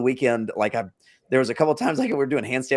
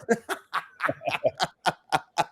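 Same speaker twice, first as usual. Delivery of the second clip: embarrassed laugh; 4 semitones higher, 4 dB quieter than usual. Real, not acted. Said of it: "after the accent attempt".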